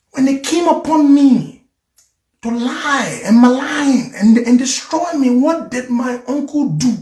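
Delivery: high, aggrieved challenge; indignant protest. Real, not acted. Challenging those who want it for the country, indignant. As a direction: voice jumps high in real indignation.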